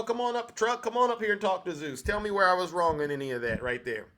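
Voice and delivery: ordinary talk, nothing marked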